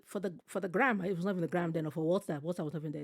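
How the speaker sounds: normal talking voice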